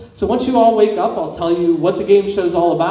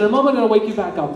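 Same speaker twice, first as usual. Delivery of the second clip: ordinary talk, nothing marked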